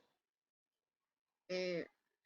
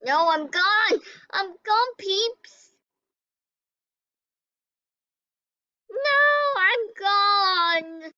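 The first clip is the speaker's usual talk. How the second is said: dramatic wail; 8 semitones higher, 4 dB louder, much slower than usual. Real, not acted. Eliminated from game.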